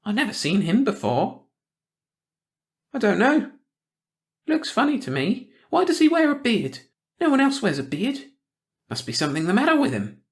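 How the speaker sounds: a prying small-town gossip sniffing out trouble; suspicious old gossip. A narrator's reading. Missus Hackett gossip voice.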